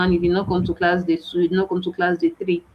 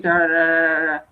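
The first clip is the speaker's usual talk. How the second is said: rattling off da da da like someone talking too fast; mock fast talker; 2 semitones lower than usual. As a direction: imitate a tutor racing through words. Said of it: "the person is a fast talker".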